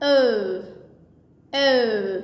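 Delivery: "Oh" as a glide closing to rounded lips. Shown as a demonstration. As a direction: draw the lips closer and round through "Oh". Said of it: "round అయ్యి pronounce చేయాలి". ("Oh" పెదవులు round చేసి పలకడం)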